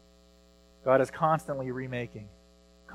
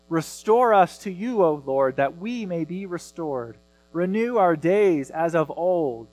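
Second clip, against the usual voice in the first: raised, fervent reading of the psalm; scripture reading; 3 semitones higher, 4 dB louder than usual. Acted. Pastor reading Lamentations to the congregation, fervent plea. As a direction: read it as a heartfelt plea to God.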